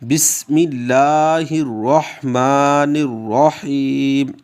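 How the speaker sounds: slow, drawn-out recitation; slow devout recitation to open the lesson. Shown as a demonstration. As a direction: chant it slowly and devoutly. Teacher recites before lesson.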